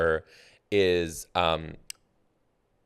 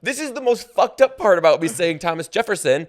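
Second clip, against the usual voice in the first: excited; 13 semitones higher, 12 dB louder than usual. Real, not acted. Excited protest after wrong answer.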